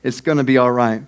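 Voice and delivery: low, soft, soothing voice; God reassuring Moses. Acted. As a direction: answer the panic with quiet calm. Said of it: "And God's like".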